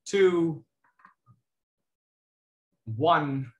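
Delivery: ordinary delivery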